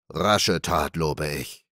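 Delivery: low, pleased bandit chief; satisfied captain. A narrator's reading. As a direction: praise it with contentment. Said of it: "sprach der Hauptmann zufrieden".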